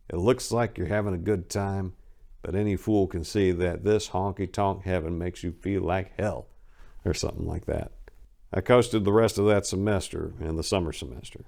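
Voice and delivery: ordinary delivery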